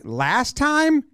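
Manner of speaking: "Last time" climbing high at the end; imitated question. Mound visit guesswork, "Last time" as a high, rising question.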